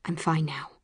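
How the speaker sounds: soft, quiet self-reassurance; said to herself. A narrator's reading. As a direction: tell yourself quietly, falling. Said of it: "this time for myself".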